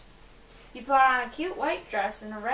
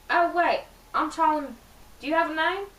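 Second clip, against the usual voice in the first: put-on higher, louder British accent; Charlie McDonald; 5 semitones higher, 5 dB louder than usual. Boy introduces himself to a stranger, in a British accent. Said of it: "said in a smooth British accent".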